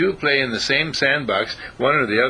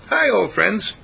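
wry greeting; 9 semitones higher, 4 dB louder, slower than usual. Pretend. Greeting the old poor phrases.

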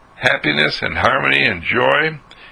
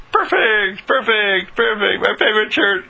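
high, bright-eyed repeated perfect; delighted; 10 semitones higher than usual. Acted. Husband admiring his own clean shirt, delighted and high. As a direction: marvel at the freshly dried shirt, pitched way up. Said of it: "puts it on and says".

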